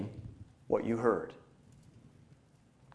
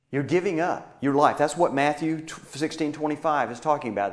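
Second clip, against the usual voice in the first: usual speaking voice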